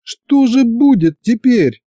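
high, slow tearful question; a hint of a sob in a girl's voice. A narrator's reading. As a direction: Masha asking the others what happens now, tearful. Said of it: "Маша вдруг тихо всхлипнула".

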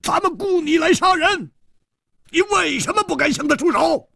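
very high, strained yelling; stamping-mad high shout; angry roar. A narrator's reading. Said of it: "天星弟子跺脚吼道".

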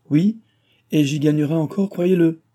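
eager insistence. A narrator's reading. Benefactor eagerly assures the engineer he will profit too, excited. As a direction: press the point with delight.

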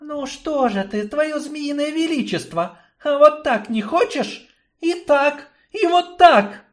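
high, rising, mocking woman's voice; taunting woman. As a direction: taunt him with relish. Yarina taunts tortured snake.

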